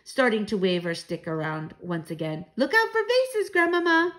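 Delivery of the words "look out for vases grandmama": high, loud cry of alarm; warning call. A narrator's reading. Child warns grandmother waving her stick, calling out.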